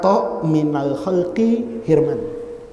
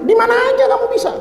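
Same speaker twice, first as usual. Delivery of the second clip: high, quick, eager reply; confident promise; faster than usual. Retold or imitated. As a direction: rattle off an easy promise high and fast. Eager over-confident reply in retold talk. (jawaban yakin, cepat dan tinggi)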